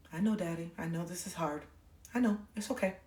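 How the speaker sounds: very soft, soothing reply; hushed, loving understanding; tender comfort. Retold or imitated. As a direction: gently reassure a parent in pain. Comforting hurting dad, retold.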